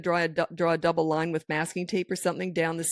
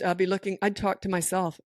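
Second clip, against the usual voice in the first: ordinary delivery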